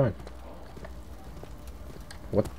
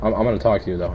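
ordinary talk

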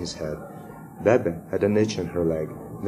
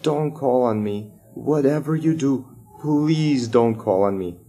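slow, higher-pitched mock scream; silent panicked plea; 5 semitones higher, 4 dB louder than usual. A narrator's reading. Pupil begging inwardly not to be picked, drawn-out mock panic. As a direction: plead desperately, stretching the words out. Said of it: "a brain screamed".

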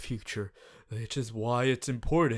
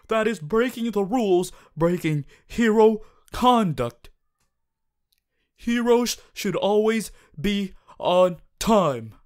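raised, firm lecture on the rules; stern scolding; 5 semitones higher, 6 dB louder than usual. Acted. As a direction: scold them for breaking hero conduct. Class president scolds late classmate.

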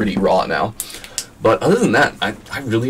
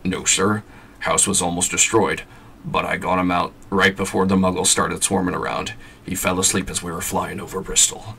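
gentle giant's rough, homely voice; Hagrid voice; 2 semitones lower, 4 dB quieter than usual. Acted. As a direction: play the loyal groundskeeper. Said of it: "You like my Hagrid voice?".

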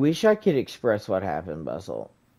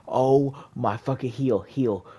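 ordinary talk

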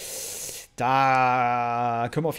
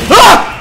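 short, loud, high shriek; sudden scream of fright; startled scream; 18 dB louder than usual. Real, not acted. Player startled by the game, short high scream.

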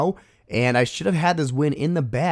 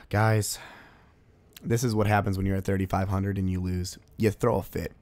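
deflated sigh; 5 semitones lower, 6 dB quieter than usual. Real, not acted. Lost raid at zero percent.

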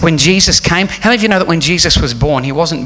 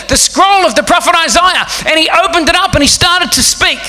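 raised, high-pitched preaching; 14 semitones higher than usual. Acted. Pastor preaching the Christmas message with force. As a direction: drive it home to the congregation.

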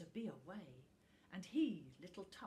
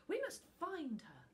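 put-on higher, louder determined boy; eager resolve; 9 semitones higher, 6 dB louder than usual. A narrator's reading. Little Tuft decides to find the dragon, eager.

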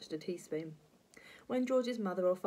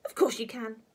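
raised, curt granny snap; a touch of temper, louder and higher; snapped retort; 4 semitones higher, 6 dB louder than usual. Acted. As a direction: snap back crossly. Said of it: "she snapped".

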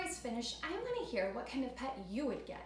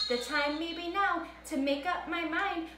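put-on bouncy storybook rhythm for kids; lively read-aloud for children; 3 semitones higher, 4 dB louder than usual. A narrator's reading. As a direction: read it like story time for little ones. Reads Seuss story to kids.

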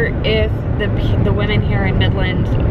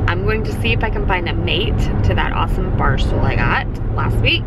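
usual speaking voice

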